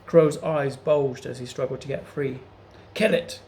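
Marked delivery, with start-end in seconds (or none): 2.9-3.4 high, loud screeching command; screeched order. A narrator's reading. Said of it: "screeched Septimus".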